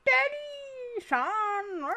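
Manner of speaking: high, drawn-out call of a game character; mock child calling. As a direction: call out high and long, playfully put-on. Voices game's father and son.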